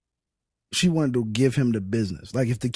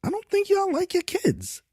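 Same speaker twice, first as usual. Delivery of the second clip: high, mock-incredulous voice; incredulous quip. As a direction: put on a high disbelieving voice for the quip. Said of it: "I was like".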